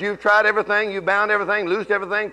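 usual speaking voice

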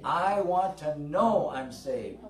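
"know" leaned on loud and slow; slow, loud stress landing on "know". Shown as a demonstration. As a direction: drag out "know" to drive it home. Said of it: "Say this with me".

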